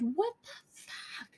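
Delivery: high, drawn-out mock scream, held back in volume; strangled, stretched-out shriek of outrage; retold scream. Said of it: "So I screamed".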